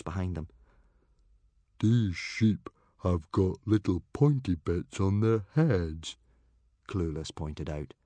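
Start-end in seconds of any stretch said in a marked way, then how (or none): 1.8-6.2 slow, dopey character voice; dim-witted character. A narrator's reading. Clueless mistaking reindeer for sheep, slow and dim. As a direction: point it out slowly and cluelessly.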